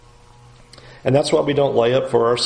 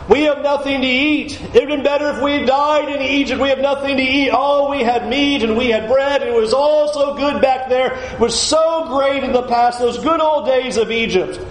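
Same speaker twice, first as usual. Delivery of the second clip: high, whining mock lament; nostalgic whiner; 11 semitones higher than usual. Voicing Israelites longing for Egypt.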